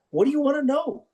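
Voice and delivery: a touch of cocky eagerness; eager. Retold or imitated. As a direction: go on, ask me. Coach answering nervous scout.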